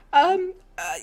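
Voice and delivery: high, scared begging voice; frightened pleading. Acted. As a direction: plead for your life, terrified. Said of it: "But he's like".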